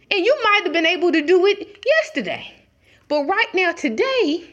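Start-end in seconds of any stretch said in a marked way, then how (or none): none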